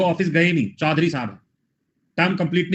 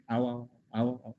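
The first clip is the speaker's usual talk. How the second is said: soft mock welcome; 3 semitones lower, 11 dB quieter, slower than usual. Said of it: "Pakistan में कह रहे".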